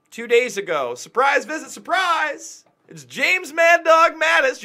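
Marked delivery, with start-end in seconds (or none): 0.9-2.6 mock surprise. Mocking Mattis's surprise visit. 2.9-4.6 mock announcer.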